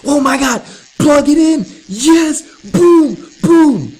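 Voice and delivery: very high, loud gleeful yelling; excited shout. Real, not acted. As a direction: shout it like a big win. Excited answer reveal.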